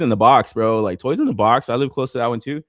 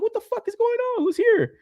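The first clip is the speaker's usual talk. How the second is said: high, mock-startled householder; mocking people startled by FedEx, high and alarmed; imitating startled people. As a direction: act jumpy at the knock. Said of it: "people are like".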